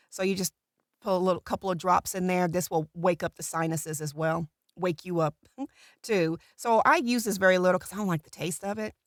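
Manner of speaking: ordinary talk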